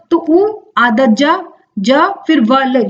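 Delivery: slowly spelling out उज्ज्वल as उ, आधा ज, ज, वल; slow spelled-out uj-jwal; syllable by syllable. Shown as a demonstration. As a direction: break the word into its parts, teacher style. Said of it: "हम बोलते हैं".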